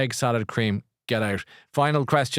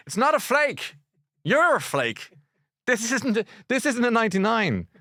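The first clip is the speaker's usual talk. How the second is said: mock indignant rant; 10 semitones higher than usual. Acted rant about the ice cream.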